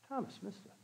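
very soft, almost whispered refrain; hushed aside. Real, not acted. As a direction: let it drop to a near whisper.

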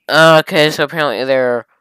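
loud, high, exasperated rant at the writer. Real, not acted. Loudly ranting at the writer about a time skip. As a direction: yell your frustration at the lazy writing.

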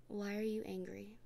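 normal talking voice

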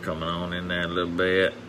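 normal talking voice

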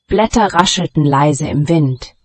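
usual speaking voice

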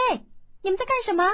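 put-on high, loud, fast girl's call; a young girl calling out from the boat window. A narrator's reading. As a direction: shout across to the boys, bright and quick. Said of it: "郭福大声叫道".

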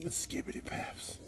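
breathy whispered chant. Pretend. Whispering silly words to wake the room. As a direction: whisper the nonsense words like a secret.

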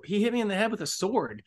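accusing the friend to the cop; dazed accusation. Retold or imitated. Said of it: "I point at my friend and go".

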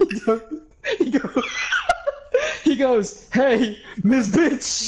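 high, laughing attempts at the quote; losing it while quoting someone; cracking up. Real, not acted. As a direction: keep restarting through real laughter. Laughing too hard to tell it.

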